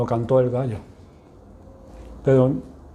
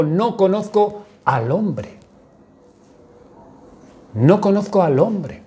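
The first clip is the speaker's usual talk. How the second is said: slower, higher, a touch of insistent denial; Peter's denial; 5 semitones higher, slower than usual. Acted. Peter swearing to the servant girl he never knew the man, insistent. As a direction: deny him twice, pleading and firm. Peter denies Jesus with oath.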